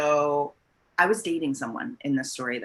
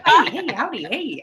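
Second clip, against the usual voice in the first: higher, louder howdy greeting; a hammed-up version of her own hello; self impression; 9 semitones higher, 5 dB louder than usual. Shown as a demonstration. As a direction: greet the crowd like a cheery host. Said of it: "I do say howdy".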